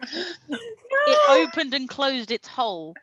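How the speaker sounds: can barely get the words out for laughing; laughing while reading. Real, not acted. Reading the silly story to friends, breaking into laughter. Reader cracking up mid-story.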